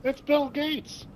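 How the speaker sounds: high, put-on pitchman voice; hammy high tech-salesman voice; Bill Gates impression. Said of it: "He goes".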